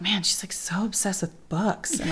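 hushed teasing about mom; whispered mock awe. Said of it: "And I'm like".

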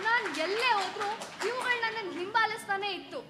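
a loud, pained protest; wife shouts that the watchers follow her everywhere; anguished outburst. Acted. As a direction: cry out loud, pressing hard on ಎಲ್ಲೇ. Wife bursts out in the quarrel.

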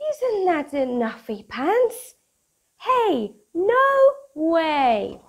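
bright, bouncy storybook read-aloud; lively picture book voice for kids; reading to children. Acted. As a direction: read it like bedtime story time for little ones.

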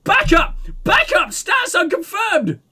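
high, loud scream. Acted. Woman screaming at the cops through her megaphone. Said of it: "raises it back again and screams".

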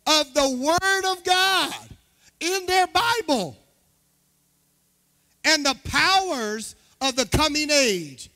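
slow, high, ringing pulpit delivery; scripture proclamation. Acted. Pastor finishes the Hebrews verse, proclaiming it forcefully. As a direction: stretch out and lift each phrase of the verse.

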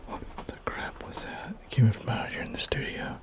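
frightened unvoiced whisper; scared whisper. Real, not acted. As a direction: whisper it, afraid something's there. Frightened after object appears.

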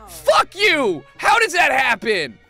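high, loud protest at losing; indignant outburst. Real, not acted. Player who just lost a fight, indignant shout. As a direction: curse loudly in disbelief.

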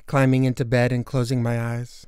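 usual speaking voice

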